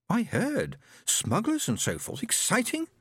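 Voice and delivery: put-on high eager girlish questions; bright curious young woman; lively sister voice.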